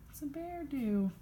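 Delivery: ordinary delivery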